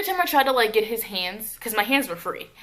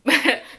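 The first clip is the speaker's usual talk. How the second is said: quick higher, louder laugh; real laugh; 6 semitones higher, 6 dB louder than usual. Real, not acted. Storyteller teasing the siblings for not helping, quick laugh. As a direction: laugh off your own jab.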